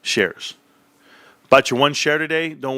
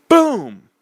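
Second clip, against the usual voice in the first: sharp, much higher, louder exclamation; excited boom; 11 semitones higher, 6 dB louder than usual. Real, not acted. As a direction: hit it like a mic drop.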